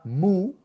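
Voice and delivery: "mu" alone with long rising U; isolated syllable. Shown as a demonstration. Teacher giving the first syllable, long rising "mu". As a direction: draw out "mu" and leave it hanging. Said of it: "Primera sílaba".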